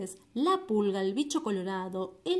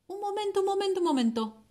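high excited louse voice; 9 semitones higher, faster than usual. Acted.

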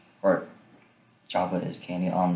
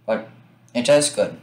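talking between bites; chewing while talking; 2 semitones higher, 4 dB louder than usual. Real, not acted. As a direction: mouth partly full of chocolate. Seen 2: Put piece in mouth at -1.0; jaw working, holding more pieces.

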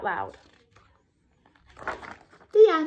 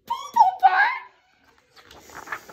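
very high, drawn-out loud squeal; silly squeal; 5 dB louder, much slower than usual. Acted. Reader forced to say the hippo's name, high silly squeal. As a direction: stretch it slowly into a goofy shriek.

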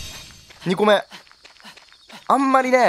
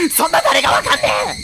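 outraged shout. Real, not acted. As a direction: shout it at the screen in real outrage. Outraged at puzzle.

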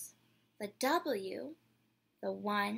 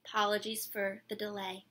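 plain reading voice

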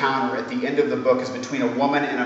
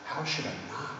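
calm lady's voice; 11 dB quieter than usual. A narrator's reading. The lady answers calmly.

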